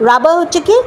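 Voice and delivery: normal talking voice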